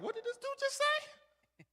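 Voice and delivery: squeaky high disbelief, about to lose it; voice going high. Retold or imitated. Rookie reacting to the coach, voice cracking high. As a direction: shoot way up high in shock. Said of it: "when your voice gets high, that means you're about to lose it".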